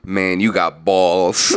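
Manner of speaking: a hint of a gruff veteran; low, slow mock tough guy. Retelling the guy leaving the bathroom, low and slow. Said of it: "he goes".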